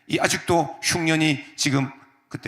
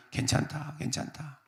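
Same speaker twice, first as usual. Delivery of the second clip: much softer, tender murmur; soft reassurance; 10 dB quieter than usual. Acted. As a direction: soothe like calming a frightened child. Joseph soothing, softly. (아주 부드럽게 속삭이듯 달래며)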